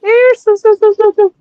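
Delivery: high, loud calling out like to a pet; hammed-up high loud call; mock calling. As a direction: call Sue as if summoning a dog.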